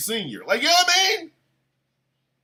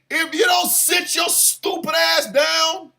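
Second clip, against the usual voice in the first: mock yell; 8 semitones higher than usual. Shouted threat.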